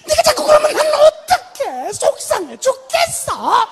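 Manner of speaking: high, whining mother voice, slow at the end; whiny upset mother. Retold or imitated. Mother whining back at her toddler in a high voice. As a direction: mimic a grown mom sulking at her three-year-old. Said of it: "멀쩡히 나이 처먹은 엄마가 앉아가지고".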